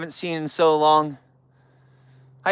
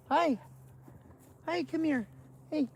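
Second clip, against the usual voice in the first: high, soft coaxing call; softer and higher, like luring a pet; coaxing bear; 10 semitones higher, 15 dB quieter than usual. Real, not acted. Calling a bear cub over, soft and high.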